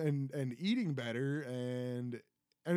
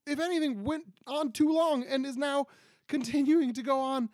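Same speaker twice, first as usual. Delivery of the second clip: worked up; 8 semitones higher, 5 dB louder than usual. Real, not acted. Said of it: "And I'm getting worked up".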